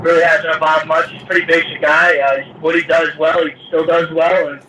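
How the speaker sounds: talking through a speakerphone. Real, not acted. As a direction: voice over a phone line. Fighter answering over conference call.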